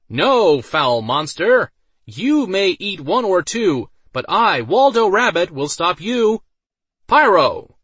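loud, high heroic challenge; heroic shout. A narrator's reading. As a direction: shout a grand battle cry. Mage heroically confronts monster.

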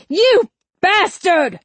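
high, loud, drawn-out yell; furious yell. A narrator's reading. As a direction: yell the insult slowly, word by word. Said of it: "Wright yelled".

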